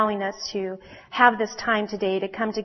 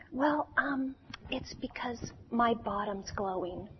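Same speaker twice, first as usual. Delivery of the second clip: beetle voice, much softer and trailing away; shy confession; 12 dB quieter than usual. Acted. Little beetle confessing to the cat, softer and shy. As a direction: mumble it out, embarrassed and small.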